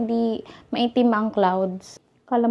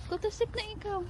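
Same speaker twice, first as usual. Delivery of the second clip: very high, hushed cooing; 10 semitones higher, 15 dB quieter, faster than usual. Real, not acted. Coaxing baby to sleep.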